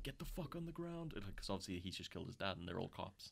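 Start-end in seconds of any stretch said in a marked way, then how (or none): 0.0-1.1 high, strained mock yell; a hammed-up police command; mock cop order. Cops ordering Henry down, high mock yell. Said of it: "And they're like".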